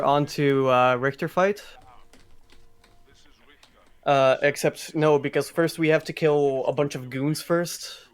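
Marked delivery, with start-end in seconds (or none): none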